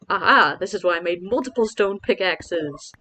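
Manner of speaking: loud, pleased outburst; triumphant. Real, not acted. Streamer finding they made spare pickaxes, triumphant. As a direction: crow over your own foresight. Triumph at own foresight with tools.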